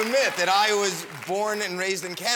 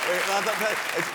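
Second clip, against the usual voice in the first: ordinary talk, nothing marked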